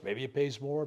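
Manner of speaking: ordinary delivery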